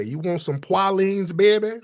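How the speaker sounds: high, slow Louisiana drawl; Louisiana accent. Acted. Louisiana local offering candy to a visitor, high Louisiana accent. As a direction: pitch it up, sweet-talking Louisiana local. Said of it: "you go down there, they say".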